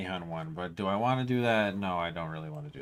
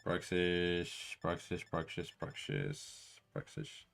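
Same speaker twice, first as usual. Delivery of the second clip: soft coaxing call; 3 semitones lower, 8 dB quieter, slower than usual. Pretend. Calling the fish softly.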